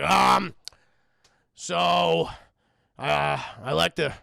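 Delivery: loud, halting, stiff offer; awkward Beast. Retold or imitated. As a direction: force out politeness with long hesitations.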